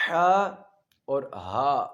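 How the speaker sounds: first ہا loud and throaty, second ہا light; two ha sounds. Shown as a demonstration. Teacher contrasting two ha letters, careful articulation. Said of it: "آگے آ جاتا ہے".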